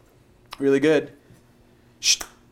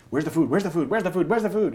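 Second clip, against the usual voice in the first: building higher with each repeat, a mock frantic search; frantic dog thoughts; 5 semitones higher than usual. Trainer voicing the excited dog searching for food, rising mock frantic. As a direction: voice the dog's mind getting more and more frantic.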